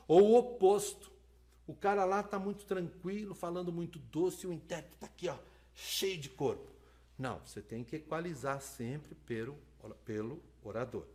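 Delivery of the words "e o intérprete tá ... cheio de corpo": ordinary delivery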